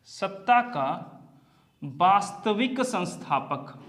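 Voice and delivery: सत्ता का वास्तविक संस्थापक, slowed right down, each word spaced; slow it down so students can note it; slow emphatic repeat. Shown as a demonstration. Teacher repeats the key term slowly for the class, spaced and deliberate.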